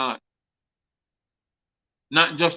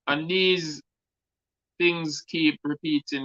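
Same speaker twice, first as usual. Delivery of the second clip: ordinary delivery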